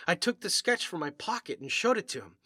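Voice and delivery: usual speaking voice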